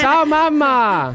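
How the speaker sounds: slow, high, stretched hello; drawn-out greeting. Real, not acted. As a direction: call it out long and bright. Greeting mother on the radio.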